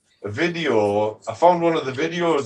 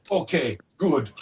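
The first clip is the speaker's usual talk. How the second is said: put-on slow, curt Russian-accented agreement; slower than usual. Wife accepting the compromise, imitated Russian accent. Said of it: "I'm from former Soviet Union".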